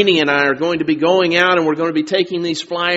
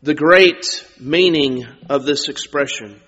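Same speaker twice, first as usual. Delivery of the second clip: normal talking voice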